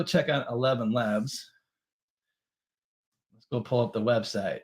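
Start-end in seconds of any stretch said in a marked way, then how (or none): none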